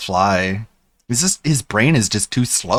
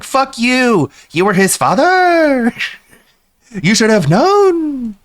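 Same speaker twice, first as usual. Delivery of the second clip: mock D S P snapping at a grieving father; 10 semitones higher, slower than usual. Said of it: "And D S P is like".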